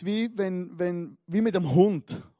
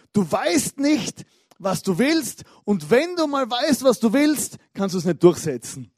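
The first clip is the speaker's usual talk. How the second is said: furious wife; 4 semitones higher, 8 dB louder than usual. Retold or imitated. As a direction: spell out his weakness, word by word. Wife attacking husband in quarrel. (wütend, laut und langsam zum Ehemann)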